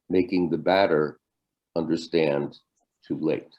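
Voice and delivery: measured verse with long pauses between phrases; slow poem reading. Acted. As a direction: read it line by line so listeners catch on. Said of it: "I'll read it slowly so you can catch on".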